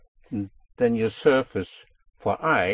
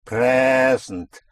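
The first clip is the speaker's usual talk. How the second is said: long, leaned-on PRE in "Present"; pushed-down stress; 4 dB louder than usual. Shown as a demonstration. Teacher demonstrating the push on the syllable, "Present" stressed long on PRE. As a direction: press hard on the first syllable of "Present" and hold it. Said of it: "that you push down".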